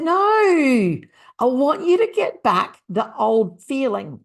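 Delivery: long, high, loud "no"; playful frustrated shout at a client; mock exasperation. Coach corrects laughing client, retold.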